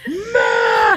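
high, loud shout; excited shout. Pretend. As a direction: yell it out.

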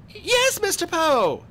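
put-on very high, loud girl's voice; high-pitched, loud reply; eager girl. A narrator's reading. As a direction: answer eagerly in a high child's voice.

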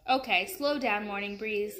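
ordinary delivery

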